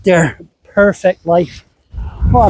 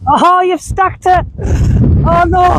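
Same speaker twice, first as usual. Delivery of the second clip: high surprised cry at a fall; 12 semitones higher, slower than usual. Real, not acted. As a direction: react to someone toppling over. Drunk man falls over on beach.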